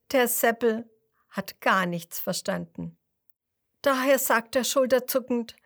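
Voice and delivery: usual speaking voice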